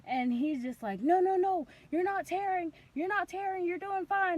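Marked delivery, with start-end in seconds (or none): 1.0-4.3 high, hurried-sounding reassurance; backtracking doctor. Retold or imitated. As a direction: imitate a doctor hastily reassuring.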